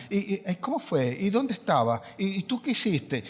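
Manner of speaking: soft, low run of nosy questions; mock prying questions. Preacher mimicking nosy visitors, softly low. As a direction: mimic the busybodies pestering someone in grief. People pestering the grieving with questions.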